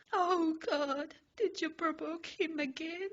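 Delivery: high, loud panicked cry; frightened, high-pitched boy; trembling fright. Acted. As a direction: squeak it out like you're terrified. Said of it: "Midoriya trembles".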